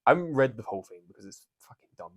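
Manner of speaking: normal talking voice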